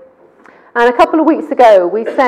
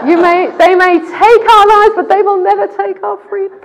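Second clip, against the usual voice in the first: laughing, hammed-up Scottish Braveheart quote, higher and louder; Scottish accent; 9 semitones higher, 6 dB louder than usual. Preacher laughingly imitates the Scottish battle speech. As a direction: belt it like a mock Scottish war cry. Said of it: "Oh, in that accent".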